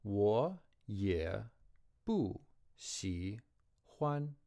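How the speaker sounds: 欢 held high and level; chunked, slow Chinese; slow tone demo. Shown as a demonstration. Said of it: "high level tone".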